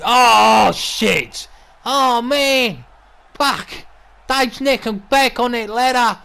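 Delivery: high-pitched burst of alarmed excitement; shocked shout. Acted. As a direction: cry out in shock like a ringside commentator. Shocked reaction to brutal match action.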